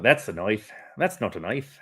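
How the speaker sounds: put-on Australian accent. Co-host quoting Crocodile Dundee after an Australian's clip, imitated Australian accent. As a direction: do a light Australian movie-quote accent. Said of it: "I love that accent".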